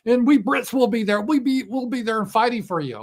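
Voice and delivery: high, loud mock cheerleading; mock European leaders. European leaders egging Zelensky on to fight, mocking high voice. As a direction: urge them on, high and loud. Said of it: "And they're like".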